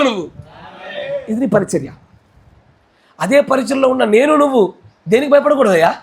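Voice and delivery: ordinary talk, nothing marked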